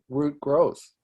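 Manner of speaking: ordinary talk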